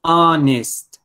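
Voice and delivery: "Honest" starting straight on the vowel, no H; silent H. Shown as a demonstration. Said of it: "no produce sonido".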